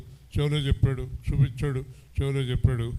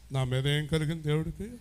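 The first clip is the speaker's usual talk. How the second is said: ordinary delivery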